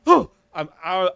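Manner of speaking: hammed-up nervous excuse; mock fright. Refusing to check the noise, loud mock panic. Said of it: "I'm like".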